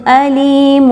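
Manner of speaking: علیم with the tanween turned to a nasal meem before با; iqlab demo. Shown as a demonstration. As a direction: say علیم slowly, the nasal sound held on meem. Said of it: "غنہ کرتے ہوئے میم کی ادائیگی ہوگی".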